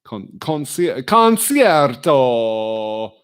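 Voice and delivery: slow, loud mock Italian concierto; hammed-up Italian accent. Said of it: "I'd say that word".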